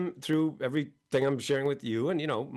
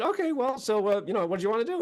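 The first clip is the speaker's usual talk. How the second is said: high teasing question; 8 semitones higher, faster than usual. Retold or imitated. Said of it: "I said".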